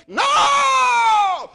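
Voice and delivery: sudden high, loud cry of refusal; shouted no. Retold or imitated. Preacher rejecting a vision of his daughter, loud cry.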